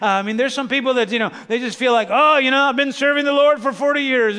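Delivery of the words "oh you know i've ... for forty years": louder, higher put-on churchgoer grumble; mock a long-suffering servant of the Lord; joyless mock complaint. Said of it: "there's just not a lot of joy about them".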